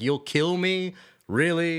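high, incredulous question; mock disbelief. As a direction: act amazed at the threat. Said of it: "I'm like".

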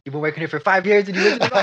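fast, loud, disbelieving rant; exasperated boss. Acted.

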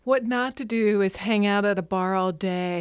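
ordinary talk

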